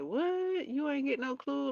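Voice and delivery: high-pitched incredulous questioning; imitating an incredulous reaction; mock disbelief. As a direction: act shocked, pitch jumping way up. Acts disbelief at missing clue.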